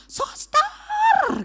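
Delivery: very high-pitched, drawn-out call for the nurse; panicked yelling. Retold or imitated. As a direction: scream down the corridor for help. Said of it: "saya teriak-teriak".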